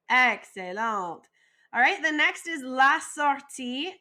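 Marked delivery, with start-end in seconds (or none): none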